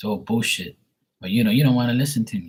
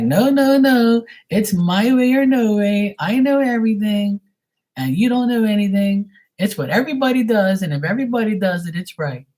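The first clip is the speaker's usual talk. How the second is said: mocking know-it-all; 9 semitones higher than usual. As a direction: parody a stubborn Leo who's always right. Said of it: "you're gonna be like".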